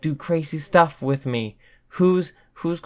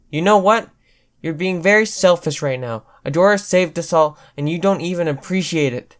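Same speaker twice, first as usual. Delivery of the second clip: angry scolding; 3 semitones higher, 6 dB louder than usual. Acted. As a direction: tell her off hard and loud. Sparkles scolding Katra.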